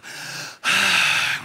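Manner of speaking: long, breathy exhale; exaggerated sigh of exhaustion; heavy sigh. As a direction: let out a big, voiceless sigh. Mock relief after crowd says it.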